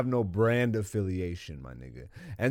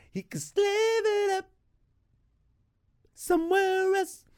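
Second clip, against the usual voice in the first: squeaky high falsetto, mocking; mock falsetto repeat. Mocking repeat of own line.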